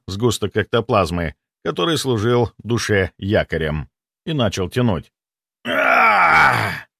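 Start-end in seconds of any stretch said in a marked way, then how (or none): 5.7-6.9 loud pained howl of a ghost; ghostly howl. A narrator's reading. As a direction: howl out in agony. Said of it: "взвыл полковник".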